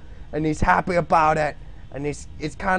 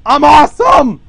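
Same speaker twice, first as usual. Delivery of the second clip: high, loud boast; triumphant shout; 10 semitones higher, 14 dB louder than usual. Real, not acted. Streamer crowing over his drawing. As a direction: yell it proudly.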